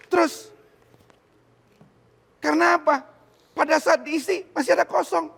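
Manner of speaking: loud, high, emphatic preaching; full-force preaching. Acted. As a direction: preach it out to the congregation with fire.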